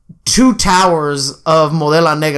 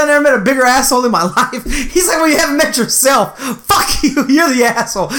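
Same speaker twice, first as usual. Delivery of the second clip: high, heated retelling through laughter; laughing mock argument; 8 semitones higher than usual. Retelling dad squabbling with a homeless man, laughing mock outrage. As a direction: play both sides of a squabble, amused.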